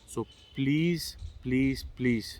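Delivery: real, repeated plea with spaced-out words; earnest pleading. Real, not acted. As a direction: plead earnestly, dwelling on each please. Begging government and viewers to stop.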